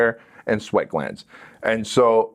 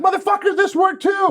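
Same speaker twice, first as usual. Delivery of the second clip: very high, gleeful mock amazement; amazed triumph; 3 dB louder than usual. Passenger finding the second chokehold worked, high amazed exclamation. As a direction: crow it in a high voice.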